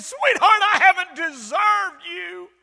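high, loud, slow cry; melodramatic last words. Acted. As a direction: wail it like his final breath. Dying husband's last words.

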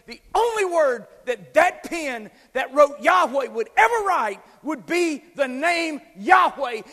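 preaching at a shouted peak; fervent shout. Real, not acted. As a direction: drive it home at full force. Preacher builds to climax.

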